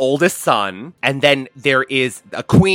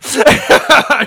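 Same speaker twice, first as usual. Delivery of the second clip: high burst of laughter; 12 semitones higher, 4 dB louder than usual. Real, not acted. Laughs at own joke.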